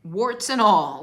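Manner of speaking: loud and slow; a fervent, drawn-out cry; emphatic shout. Real, not acted. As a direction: punch out each word. Coach punches key phrase.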